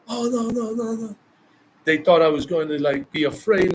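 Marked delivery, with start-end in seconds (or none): 0.1-1.2 high, soft "oh no no no"; mock scared men. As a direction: imitate men retreating nervously. Men backing down, voiced.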